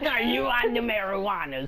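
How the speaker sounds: high, loud granny voice; little old lady voice. Retold or imitated. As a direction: squeak it like a fussy grandma. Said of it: "I just love that voice".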